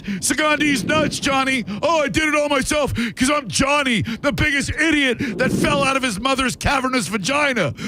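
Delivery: high, mocking voice ending in a shout; mocking Johnny. Host mocking caller Johnny in a goofy high voice. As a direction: taunt him in a goofy high voice.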